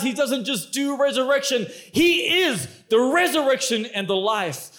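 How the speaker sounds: ordinary talk, nothing marked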